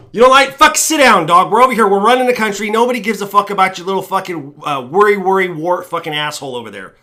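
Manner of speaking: loud, high-pitched tirade easing off; acted angry dismissal, loud at first; brash politician's put-down. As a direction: shout down an objector. Acts himself as politician dismissing objectors.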